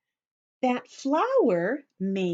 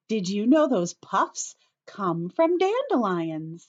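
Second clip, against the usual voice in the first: ordinary delivery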